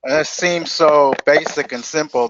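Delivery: usual speaking voice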